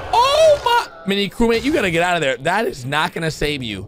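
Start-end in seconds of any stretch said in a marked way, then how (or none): 0.1-1.1 high, loud shocked oh my; real shock at the screen; shocked gasp. Real, not acted. Gamer shocked by a scary moment, high loud gasp.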